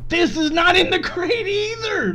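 high, loud frustrated cry of disbelief; frustrated yell. Real, not acted. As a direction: let the frustration burst out loud. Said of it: "I don't wanna yell".

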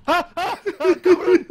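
high, loud laughing curse; shrieking laughter. Real, not acted. Player shoved into lava by friend, loud laughing outcry. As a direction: cry out while cracking up.

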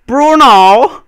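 very high, loud drawn-out shout; Bruno. Pretend. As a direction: holler the name with a rising end. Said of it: "just be like".

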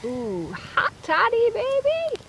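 drawn-out whoop rising very high; playful delight. Real, not acted. Camper greeting a hot toddy, playful delight.

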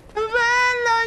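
squeaky high bello; high-pitched voice. Pretend. Repeated exclamation, high voice. (voce acuta)

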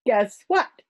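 loud, high, bursting with news; happy, excited. A narrator's reading. Mother greeting the children with a letter, happily excited. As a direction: announce it with delight. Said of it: "She looked very happy".

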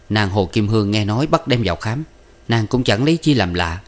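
plain reading voice

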